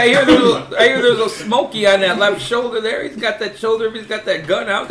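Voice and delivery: loud playful trucker radio call. Pretend. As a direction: warn the convoy like a CB trucker. Trucker CB warning about police.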